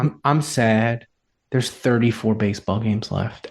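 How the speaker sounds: droop it like a wife mourning the season's end; mock sad wife. Husband mimics wife sad about few games left, mock-sad and low. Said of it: "she'll be like".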